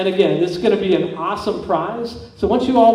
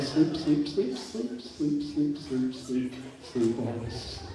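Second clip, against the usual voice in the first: fading softer and lower; hypnotic sleep command; 3 semitones lower, 10 dB quieter, much slower than usual. Acted. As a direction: lull them down to sleep. Hypnotist putting volunteers to sleep.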